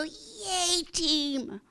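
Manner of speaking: high, drawn-out fan's cheer; excited cheer. Acted. Football fan celebrating a touchdown, excited high cheer. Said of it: "jump up and down and go".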